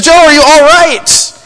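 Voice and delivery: voice from above. Retold or imitated. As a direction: call down with concern. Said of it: "He says".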